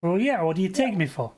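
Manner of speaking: put-on New York accent. Neighbour defending himself to the investigator, indignant New York accent. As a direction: protest like a New Yorker. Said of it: "his thick New York accent".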